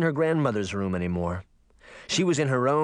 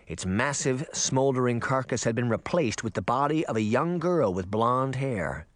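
ordinary delivery

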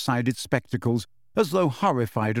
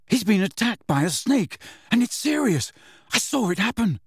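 higher, insistent; urgent; 8 semitones higher than usual. A narrator's reading. Harry telling McGonagall about the attack, urgent. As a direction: make her believe.

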